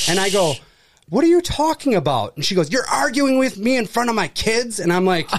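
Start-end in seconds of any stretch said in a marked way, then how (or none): 1.1-2.3 baffled, high-pitched retort; retold disbelief. Worker answering an angry customer's accusation, baffled and incredulous. Retells own baffled reply to her. 2.7-4.8 imitating an indignant customer, higher pitched; mock-outraged accusation, pitched up; retold indignation. Angry mother scolding the store worker, retold with high indignation.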